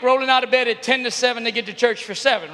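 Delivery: ordinary delivery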